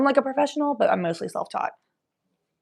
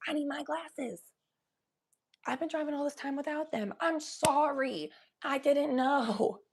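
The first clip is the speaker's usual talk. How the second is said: higher, sassy imitation of herself; mock sass; 6 semitones higher than usual. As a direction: sass the eye doctor, then fade apologetic. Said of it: "I'm just like".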